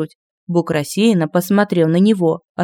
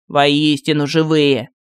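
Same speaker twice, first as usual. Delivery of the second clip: slow, lower pompous proclamation; hammy stage actor; 4 semitones lower, slower than usual. Acted. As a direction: declaim it like a grand tragedian. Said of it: "с пафосом воскликнул Мар".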